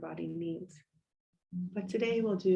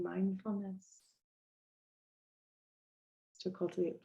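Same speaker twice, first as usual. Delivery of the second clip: unhurried body-scan guidance with long pauses; guided meditation. Real, not acted. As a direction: gently lead them into stillness, letting phrases settle.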